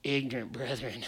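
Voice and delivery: low, soft, mocking aside; mock pity. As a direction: shake your head and mutter it with pity. Mocking the doubting believers.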